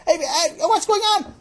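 high, loud calling out; acted high-pitched alarm; alarmed protest. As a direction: yell after departing friends. Abandoned Sammy calls after friends.

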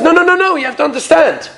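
high, insistent interruption; acted rabbi rushing in to correct him; urgent reassurance. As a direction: cut in urgently on a high pitch, then ease down. Rabbi urgently consoles the sobbing innkeeper.

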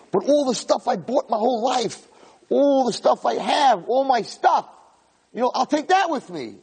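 hopeful. Acted. Soul grasping at possessions.

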